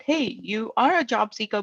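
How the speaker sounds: ordinary talk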